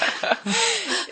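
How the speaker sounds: short squeaky laugh; high giggle. Real, not acted. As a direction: giggle at herself. Laughs at own remark.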